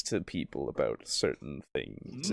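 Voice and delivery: very low, hushed and savouring; relishing NPC voice. Shown as a demonstration. Player demonstrates faction leader talking to people, low relishing hush. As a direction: sink low and soft, enjoying the words. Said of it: "really take his time enjoying how he talks".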